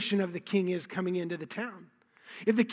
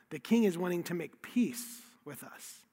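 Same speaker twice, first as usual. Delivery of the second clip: ordinary talk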